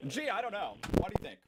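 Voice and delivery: high, loud sarcastic wondering; mock clueless. Answering why he speaks British English, mock clueless. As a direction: play exaggerated puzzlement. Mocking a silly question.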